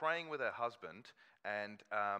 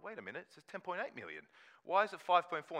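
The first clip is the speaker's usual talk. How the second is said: mock outrage, louder and rising; retold disbelief; 7 semitones higher, 7 dB louder than usual. Rereading the solicitor's letter, a mock indignant outburst. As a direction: act out catching the scam mid-read. Reacting to halved inheritance letter.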